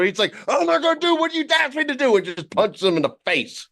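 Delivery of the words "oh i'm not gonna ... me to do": high, fast mock outburst; hammed-up, high-pitched refusal; imitated traumatized child. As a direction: blurt it as a kid having flashbacks. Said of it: "they had flashbacks to something".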